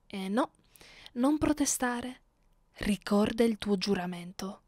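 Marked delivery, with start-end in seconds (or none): none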